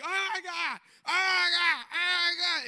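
high, loud panicked crying shout; mock panicked shrieking. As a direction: scream it in horror at the broken arm. Arm broken, friend screams.

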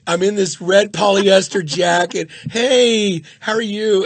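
rising from despair to fake cheer; mortified inner voice. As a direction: groan kill me then force a bright hello. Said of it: "and I'm like".

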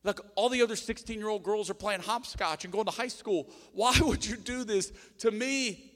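put-on protesting young Mary. Teenage Mary in labour asking God why, hammed-up protest. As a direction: cry out a hammed-up 'why me' plea.

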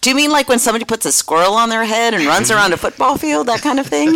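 fast, high mocking question; quick high teasing disbelief; mock incredulous question. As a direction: poke fun at the idea, rising high at the end. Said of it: "And I'm like".

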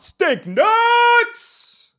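high, drawn-out scream; screamed phrase. Shown as a demonstration. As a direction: scream it out, stretching the last word. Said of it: "other things that I scream".

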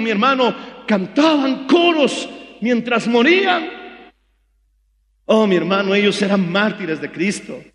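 ordinary talk

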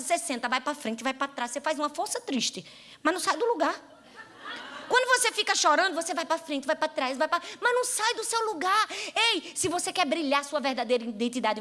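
usual speaking voice

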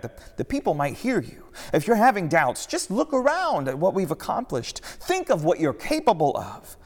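high, loud, pleading disciple; frantic Peter. Acted. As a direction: Peter begging his master not to give up. Narrator voices frantic Peter.

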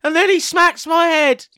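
put-on shouty little kid; child voice. Voicing a child on TV.

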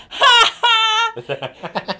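shrill burst of cackling laughter, then a drop; horrific cackle. Retold or imitated. In a show, laughing through a serious scene with a piercing cackle. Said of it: "that sounded awful for the podcast".

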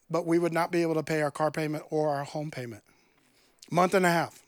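ordinary talk, nothing marked

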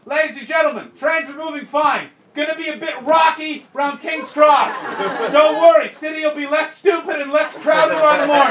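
high, projected mock announcement; station announcer. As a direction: call it out over a crowded platform. Imagined station announcement to passengers.

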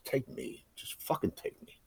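whispered plea, unvoiced and soft; whispered prayer. Begging God to take her instead, whispered.

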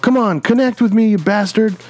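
high-pitched, acted goading of a beast; defiant taunt. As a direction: taunt it like a hero on a suicide attack. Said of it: "He's like".

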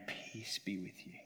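slow, soft whispered blessing. Acted. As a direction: breathe the greeting gently. Risen Jesus speaks to disciples.

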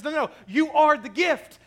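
lifted, insistent voice of the world pushing back. Retold or imitated. Preacher voicing the world's claim, loud and insistent. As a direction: push back loudly like the world talking. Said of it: "the world pushes us to a place that says".

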